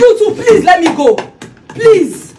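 high, insistent begging; dramatic pleading. Acted. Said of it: "why are you being so dramatic?".

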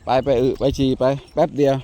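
firm order. Real, not acted. Ordering dog out to pee.

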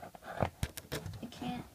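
plain reading voice

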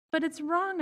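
put-on high insistent little girl; child protesting. A narrator's reading.

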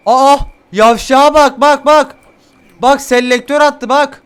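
loud, high disbelieving shout; outraged yelling. Real, not acted. Gamer shouts in disbelief at the enemy, loud.

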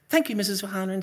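high, eager polite schoolboy; sweet as pie for the teacher; good boy voice. Acted. Boy thanking his teacher in front of class, good-boy voice. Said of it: "in my good boy voice".

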